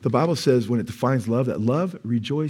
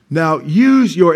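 sarcastic proclamation; 3 semitones higher, 9 dB louder, much slower than usual. Shown as a demonstration. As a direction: taunt them slowly and loudly. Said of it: "sarcastic declaration".

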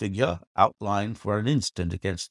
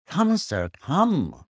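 higher, urgent call up the stairs; a mild cry of eager excitement; old man hurrying his guest along; 9 semitones higher, slower than usual. Acted. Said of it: "cried Franklin".

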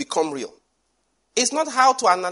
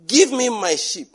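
loud, higher and slow, claiming it back; forceful slow demand; 6 semitones higher, 5 dB louder, slower than usual. Acted. David shouting at the lion for his sheep, slow loud demand. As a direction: bellow it at the lion, no fear.

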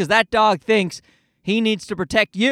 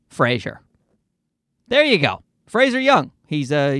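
usual speaking voice

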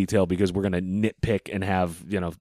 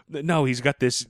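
ordinary talk, nothing marked

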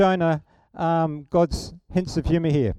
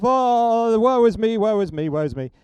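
hammed-up self-pitying wail, loud then fading to plain; mock whine that starts loud and trails off; hissy fit; 3 semitones higher, 6 dB louder than usual. Jonah sulking at God, mock wailing woe is me. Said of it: "another hissy fit".

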